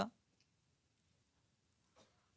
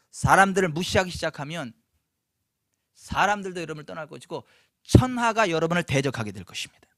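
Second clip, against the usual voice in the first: ordinary talk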